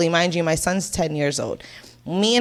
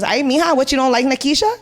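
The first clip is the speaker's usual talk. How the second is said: passive aggressive grandma; 6 semitones higher, 4 dB louder than usual. Retold or imitated. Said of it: "really passive aggressive, but smiling".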